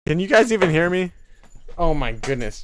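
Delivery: ordinary talk, nothing marked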